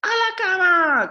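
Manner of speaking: high, loud call of back to bed; shouting. A narrator's reading. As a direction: call it out loud across the house. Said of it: "gritó Ted".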